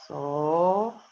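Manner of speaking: «Sólido» con la primera sílaba muy estirada; sílaba alargada al escribir. Real, not acted. Dicta mientras lo escribe. (alargando la primera sílaba al escribir)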